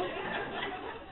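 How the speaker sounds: soft airy chuckle; laugh. Real, not acted. Laughing at her younger self's question. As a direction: chuckle under the breath.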